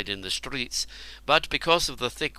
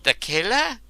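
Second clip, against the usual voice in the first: high cracking question, voice breaking; 10 semitones higher, 4 dB louder than usual. A narrator's reading. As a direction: let the voice crack in fear. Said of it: "his voice breaking".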